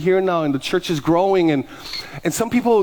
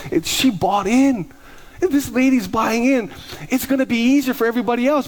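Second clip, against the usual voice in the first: excited; 8 semitones higher than usual. Retold or imitated. Pastor telling congregation the lady bought in, excited and higher. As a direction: savor it, then tumble forward with glee.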